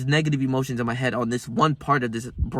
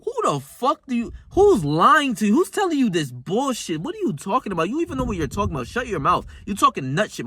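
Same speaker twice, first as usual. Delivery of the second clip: higher, mock-outraged questioning; scolding inner voice; 7 semitones higher than usual. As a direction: grill your doubting self, pitched up. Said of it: "I'm like".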